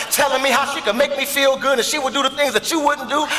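ordinary talk

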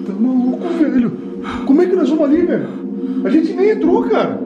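found footage panic. Real, not acted. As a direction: refuse in a panic. Panicked refusal. (recusa em pânico, voz alta e aguda)

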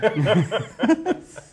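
loud high laugh; high laugh at his own joke. Real, not acted. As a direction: burst out laughing.

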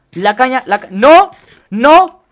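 loud, high cries of no; real panic, voice leaping up; panicked yell. Real, not acted. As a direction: shout in sudden alarm. Panics under attack in game.

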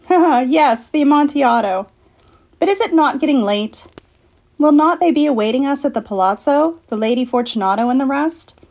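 high, strained and giggling; nervous laughing Fortunato. Acted. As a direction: keep up the laugh, voice pitched high. Chained Fortunato laughs, pleads lightly.

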